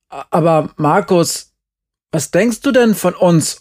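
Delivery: high, stammering dismay; shocked. A narrator's reading. Uwe shocked by the accusation.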